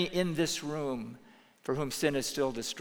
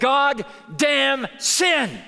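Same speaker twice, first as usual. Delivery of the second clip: slow, drawn-out loud shout; Jesus' imagined angry cry, shouted slowly; shouted curse; 11 semitones higher, 9 dB louder, much slower than usual. Retold or imitated. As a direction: hurl it out slowly in fury. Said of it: "I think he said".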